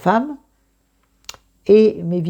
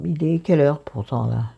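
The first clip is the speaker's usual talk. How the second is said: normal talking voice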